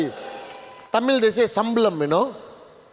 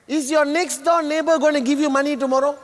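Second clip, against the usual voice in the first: normal talking voice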